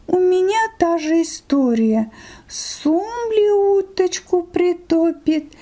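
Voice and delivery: a sly fox sharing her woes. Acted. Fox answers the sheep.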